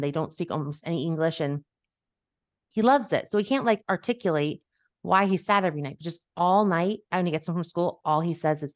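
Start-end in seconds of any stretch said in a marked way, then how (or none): none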